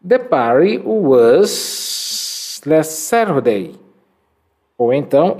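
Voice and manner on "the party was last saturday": "The party was last Saturday." said very slowly and deliberately; slow model reading. Shown as a demonstration. Teacher reads the answer slowly for students to copy. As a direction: draw out every word for the class to repeat. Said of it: "Repitam comigo".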